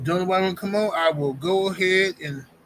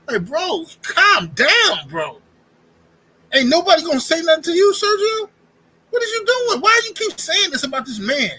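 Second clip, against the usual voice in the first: much higher and louder, incredulous yelling; exasperated outburst; 11 semitones higher, 6 dB louder than usual. Acted. Host yelling at Sergio to calm down, loud incredulous. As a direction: shout him down in disbelief.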